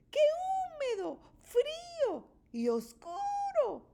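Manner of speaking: very high, squeaking little mouse voice; a tiny mouse squeaks in the cold; squeaky mouse. A narrator's reading. Mouse squeaks in the dark damp cave, high and small. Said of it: "dice Ratón mientras chilla".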